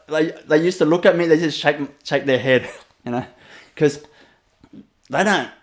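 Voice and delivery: usual speaking voice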